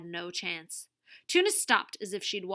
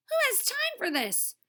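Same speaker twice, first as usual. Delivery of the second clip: much higher exasperated voice; 4 dB louder than usual. A narrator's reading. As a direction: throw up hands, fed up. Wahoo exasperated.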